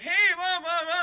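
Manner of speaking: high mock strangers' babble; mocking chatter. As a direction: toss off the blah-blah in a silly high voice. Mimicking strangers talking to him.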